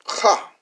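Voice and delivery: "cha" high and loud with a throaty CH; loud, high, raspy "cha" demo; rasping H. Shown as a demonstration. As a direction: punch out "cha" with a rasped H. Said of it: "it got like a rasping H sound".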